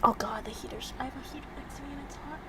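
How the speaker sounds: fast whispered aside. Real, not acted. Complaining about the heater, fast whisper. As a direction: rush it in a whisper.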